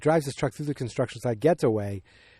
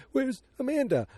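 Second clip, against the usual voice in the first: mock distress; 12 semitones higher than usual. Acts out the missing emotional scene.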